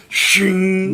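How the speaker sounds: high ringing blade sound; a bright metallic shing; sword shing. Acted. Sword sound effect in combat.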